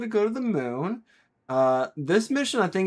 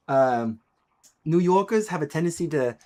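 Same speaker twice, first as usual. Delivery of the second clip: ordinary delivery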